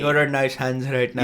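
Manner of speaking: put-on Indian accent; a light stab at an Indian accent. Co-host mimicking a call-centre worker, Indian accent. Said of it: "That's an Indian accent".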